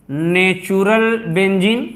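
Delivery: Natural benzene spelled out slowly, each word drawn out; slow and clear. Shown as a demonstration. Teacher asking students to listen carefully, slow clear naming. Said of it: "ध्यान से सुनना".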